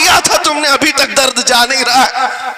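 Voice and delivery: fast, high outburst; indignant high-pitched demand; heated challenge. Real, not acted. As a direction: fire the question at opponents. Mock-challenging the opponents aloud.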